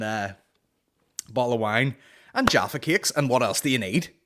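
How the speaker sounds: ordinary talk, nothing marked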